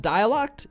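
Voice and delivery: dialect ending like "locked"; slipped pronunciation. Real, not acted. Streamer tripping over dialect, saying it "DIE-a-lockt". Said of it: "That's a very heavy form of my accent".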